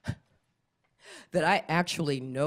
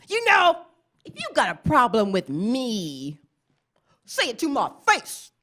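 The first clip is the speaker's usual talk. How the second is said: mock tough youngster; 7 semitones higher, 4 dB louder than usual. Young people voiced challenging.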